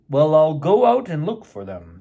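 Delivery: a hint of a grown-up father voice; pretend father. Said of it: "said Peter".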